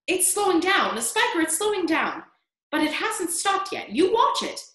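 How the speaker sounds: high-pitched, insistent voice; excited bickering. Acted. Aunts bicker excitedly over peach.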